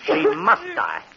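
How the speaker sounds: slow cold villain, ending in a laugh; slow sinister gloating; villainous resolve. Acted. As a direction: savor the murderous idea. Man decides to let her die.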